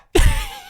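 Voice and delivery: high, loud laugh; squealing laugh; high burst of laughter. Real, not acted. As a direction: laugh out at the joke. Laughs at own retort.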